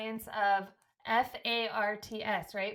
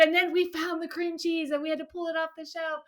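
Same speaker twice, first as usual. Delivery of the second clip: higher-pitched imitation of an excited kid; excited child, retold; 7 semitones higher, 4 dB louder than usual. Mom quoting her son's grocery store story, excited and higher. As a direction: play an eager child reporting a find. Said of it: "He was so excited".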